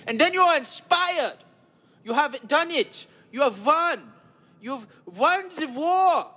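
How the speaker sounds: high-pitched victory proclamation; hammed-up motivational climax; mock triumph. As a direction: declare it grandly in a German accent.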